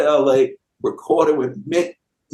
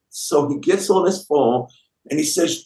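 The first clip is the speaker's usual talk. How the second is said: normal talking voice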